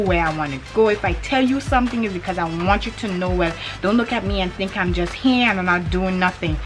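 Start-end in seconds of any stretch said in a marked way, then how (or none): none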